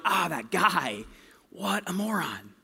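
slow, then a whispered jeer; muttered insult. Retold or imitated. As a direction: sneer it under your breath as you drive past.

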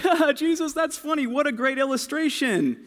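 high, laughing delight; amused crowd. Retold or imitated. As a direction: chuckle along with a clever joke. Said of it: "So everyone's like".

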